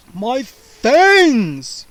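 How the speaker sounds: loud vampire cry of dismay; vampire discovers his fangs gone. A narrator's reading. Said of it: "he cried finally".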